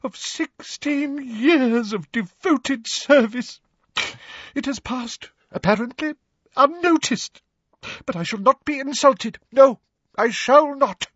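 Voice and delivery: high, overwrought wounded voice; choked halting phrases building to loud refusal. A narrator's reading. Professor Trelawney lamenting unnoticed service, choked and indignant. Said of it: "she choked".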